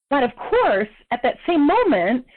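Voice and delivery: ordinary delivery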